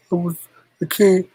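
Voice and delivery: usual speaking voice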